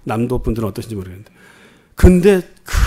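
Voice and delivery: ordinary delivery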